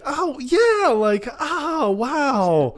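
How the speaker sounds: very high, gushing mock realization; feigned recognition. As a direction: play along as if you suddenly remember. Imitates Nancy pretending to remember.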